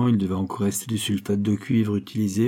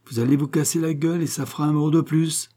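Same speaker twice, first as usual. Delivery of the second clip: high-pitched scolding warning; yelled warning; 6 semitones higher than usual. A narrator's reading. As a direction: warn them off in a raised voice. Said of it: "à grands coups de gueule".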